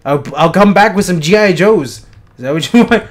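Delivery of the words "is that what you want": usual speaking voice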